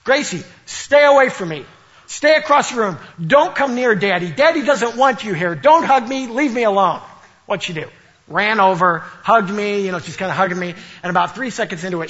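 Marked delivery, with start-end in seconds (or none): 0.0-7.0 higher, louder playful pushing away; mock rejection. Dad teasing his toddler, telling her to stay away. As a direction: tease her by pretending to push her off. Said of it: "I'm gonna test this".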